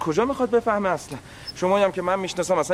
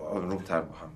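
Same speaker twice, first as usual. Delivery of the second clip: hushed calming; 11 semitones lower, 10 dB quieter than usual. Acted. Calming two arguing women.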